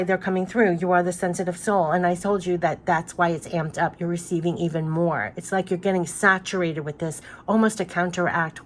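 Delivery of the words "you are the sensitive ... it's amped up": usual speaking voice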